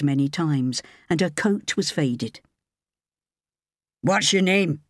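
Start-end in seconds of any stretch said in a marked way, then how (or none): 4.0-4.8 mildly barked, sharp question; understated bark in a sharp tone. A narrator's reading. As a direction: ask it sharply, like a brusque boss. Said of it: "he barked".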